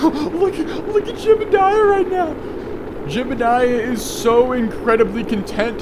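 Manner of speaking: squeaky high excited voice for Jebediah; high silly voice. Retold or imitated. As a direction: pipe it up high like a cartoon kerbal. Gamer voices Jebediah's bliss.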